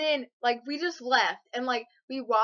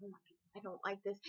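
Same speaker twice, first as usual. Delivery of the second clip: much softer, frightened aside; scared mutter; 3 semitones lower, 14 dB quieter than usual. Retold or imitated. Scared of heights at seats.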